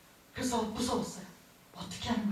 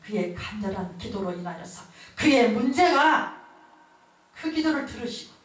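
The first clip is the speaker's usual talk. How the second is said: raised, ringing sermon voice; building fervent preacher delivery; fervent preaching; 4 semitones higher, 4 dB louder than usual. Acted. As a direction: preach it to the congregation with conviction. Preaching at full force.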